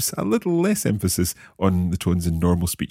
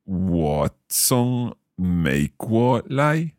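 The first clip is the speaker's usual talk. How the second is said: very slow, syllable by syllable; slow learner's repeat; much slower than usual. Shown as a demonstration. Learner repeating the teacher's Chinese phrase, very slowly. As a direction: draw out each tone carefully. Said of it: "saying each of these phrases quite slowly".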